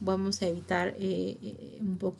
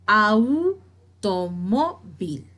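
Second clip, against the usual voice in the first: "Automóvil" very slowly, syllable by syllable; split into syllables; 4 dB louder, much slower than usual. Shown as a demonstration. Teacher showing students how the word divides, "Automóvil" syllable by syllable. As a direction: break "Automóvil" into au, tó, mo, vil with gaps. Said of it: "Estamos dividiendo automóvil".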